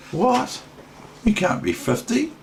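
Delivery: mock disbelief. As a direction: play it as if you can't believe it. Mock disbelief at being fifty.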